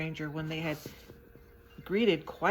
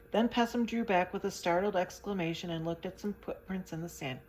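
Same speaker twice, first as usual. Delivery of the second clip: usual speaking voice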